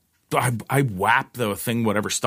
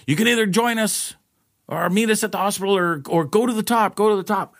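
retold yelling; 9 semitones higher than usual. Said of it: "yelling to the other girls going".

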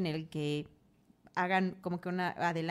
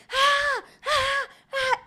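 high, loud screams from a nightmare; waking scream; 6 dB louder, much slower than usual. Acted. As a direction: jolt awake screaming. Despierta gritando de la pesadilla. (gritos agudos al despertar)

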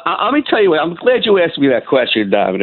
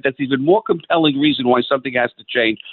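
ordinary talk

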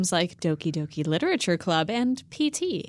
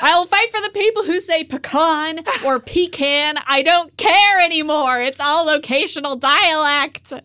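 rallying cry; 7 semitones higher, 6 dB louder than usual. Real, not acted. Rallying declaration.

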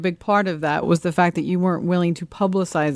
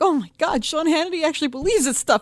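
lightly voiced, higher gasping outrage; a touch of pearl-clutching critic; mock horrified reader, pitched up; mocking shocked liberals; 7 semitones higher than usual. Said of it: "They're like".

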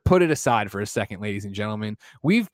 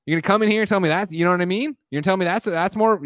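ordinary delivery

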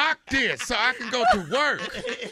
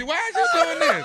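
higher, louder, fast, laughing; mock exasperation; 8 semitones higher, 5 dB louder, faster than usual. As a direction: complain like a worn-out boyfriend. Acting the puzzled boyfriend.

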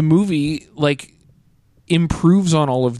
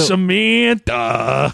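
drawn-out New York call on the name; Tony Danza; 3 semitones higher, much slower than usual. Acted. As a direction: stretch the name in mock New Yorkese. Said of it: "everybody in this book is Tony Danza".

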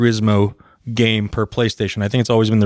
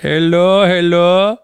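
put-on higher, sing-song Indian accent greeting; 5 semitones higher, 3 dB louder, much slower than usual. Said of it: "I don't feel bad doing an Indian accent".

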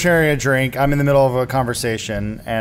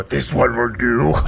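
demon voice in head. Acted. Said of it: "I hear the voice in my head to go".

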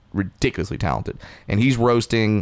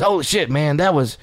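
higher, hyped praise; amazed backstage; 6 semitones higher than usual. Retold or imitated. Lil Rel backstage praising the bit, excited. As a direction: gush about the set. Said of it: "and goes".

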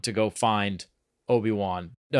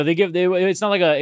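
ordinary talk

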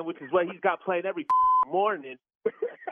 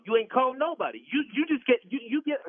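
sharp, high angry outburst; 6 semitones higher than usual. Real, not acted. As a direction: cut him off, furious. Angry victim of prank call.

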